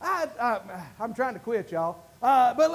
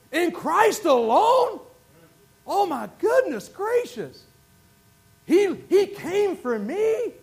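slow, high, swelling preacher's cry; slow, loud gospel fervour; fervent preaching; 6 semitones higher, 4 dB louder, slower than usual. Acted. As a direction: preach it slowly, building to a shout. Preaching at full force.

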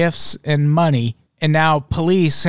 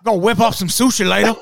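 higher-pitched, hammed-up Southern voice; mock Southern accent; 7 semitones higher, faster than usual. Host playing Yakuza from Japan, mock Southern accent. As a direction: put on a broad comic Southern accent. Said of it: "that's the accent they get".

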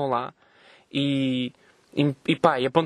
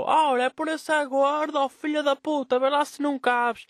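high, slow mocking yell; high shrill-pitched retort, slowed; 10 semitones higher, slower than usual. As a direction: shout it back across the street like an offended woman. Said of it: "ela gritou".

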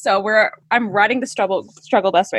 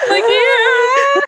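high, loud mock wail; mock crying; 13 semitones higher, 5 dB louder than usual. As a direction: wail it like a fake sobbing breakdown. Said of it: "like, cry".

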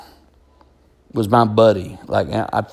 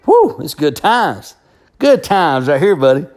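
a proud rooster purring contentment, pitched up; rooster's inner voice; 4 semitones higher than usual. Retold or imitated. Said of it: "He's thinking".

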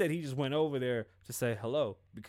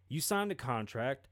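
normal talking voice

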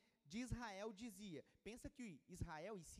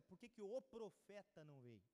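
ordinary delivery

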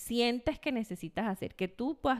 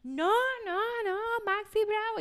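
put-on toddler refusal; 9 semitones higher than usual. Mother voices refusing toddler.

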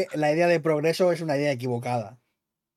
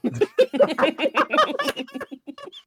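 high burst of laughter; 12 semitones higher than usual. Real, not acted. Laughing at own pun.

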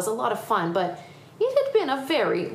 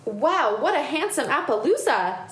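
higher, impressed exclamation; admiring; 6 semitones higher than usual. Acted. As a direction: marvel at a fine horse. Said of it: "admiring the horse".

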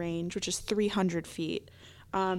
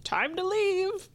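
high, raised call to leave; put-on yell, pitched way up; mock yell; 11 semitones higher, 4 dB louder than usual. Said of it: "illusion of someone yelling".

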